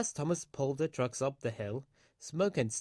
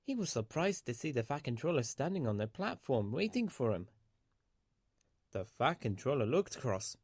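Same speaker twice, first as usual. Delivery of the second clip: ordinary delivery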